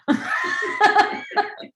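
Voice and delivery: high, loud cackling laugh. Real, not acted. Trying to make her face, real laughing fit. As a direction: crack up at your own attempt.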